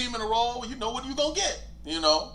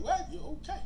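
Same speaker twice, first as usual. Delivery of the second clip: puzzled high question; 6 dB quieter than usual. Retold or imitated. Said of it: "I was like".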